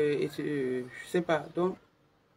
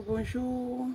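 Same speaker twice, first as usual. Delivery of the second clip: soft voice; 6 semitones higher, 6 dB quieter than usual. Shown as a demonstration. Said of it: "On va parler doucement".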